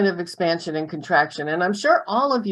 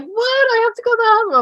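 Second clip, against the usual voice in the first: shocked protest; 14 semitones higher, much faster than usual. Retold or imitated. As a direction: burst out in disbelief. Retold shocked pregnant friend.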